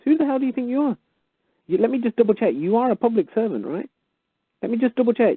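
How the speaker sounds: high-pitched outraged challenge to the official; indignant demand. Pretend. Claimant writing back to the rejecting official, high and outraged. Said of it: "You write back and you say".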